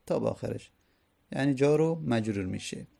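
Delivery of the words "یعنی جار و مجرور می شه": normal talking voice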